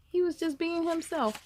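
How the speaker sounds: usual speaking voice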